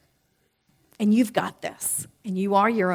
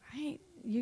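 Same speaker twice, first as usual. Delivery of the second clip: ordinary talk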